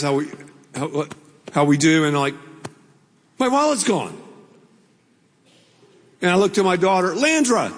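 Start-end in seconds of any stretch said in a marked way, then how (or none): 3.4-4.2 higher-pitched mock alarm; sudden pitched-up realization, retold. Preacher re-enacts finding his wallet gone, mild alarm. 7.1-7.7 higher, falling call of a name; mock accusing call. Father confronts his daughter over lost wallet, mock accusing.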